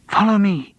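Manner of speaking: high, urgent call; urging. A narrator's reading. Studio guide leading group in the dark, urging. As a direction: urge them onward. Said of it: "urged Sidney".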